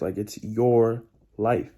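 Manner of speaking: "your" leaned on, the line slowed right down; slow, stress "your" in "it's your life"; drawn-out "it's your life" with weight on "your"; slow emphasis on YOUR. Shown as a demonstration. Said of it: "let's have some emphasis".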